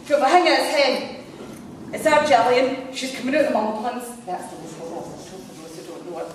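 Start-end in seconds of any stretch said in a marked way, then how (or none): 0.2-4.1 chatty Coatbridge caller voice; Coatbridge accent. Retold or imitated. Caller telling reception about Gillian, Coatbridge accent. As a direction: imitate a Coatbridge woman explaining. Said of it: "in her best Coatbridge accent".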